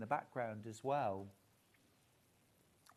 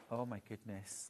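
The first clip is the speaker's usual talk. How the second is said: usual speaking voice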